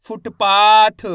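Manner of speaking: फुटपाथ loud, with a long held पा; syllable-by-syllable reading. Shown as a demonstration. Teacher blends letters for learners, loud syllabic फुटपाथ. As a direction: sound out फु-ट-पा-थ emphatically. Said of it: "एक एक अक्षर का उच्चारण".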